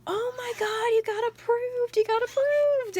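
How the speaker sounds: very high, loud squeal of joy; mom's thrilled squeal. Retold or imitated. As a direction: shriek it like a mom hearing great news. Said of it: "she's like".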